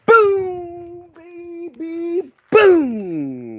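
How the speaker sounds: loud, high drawn-out booms; hyped catchphrase. Pretend. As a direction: belt out the booms like a show opener. Hyped intro catchphrase opens the show.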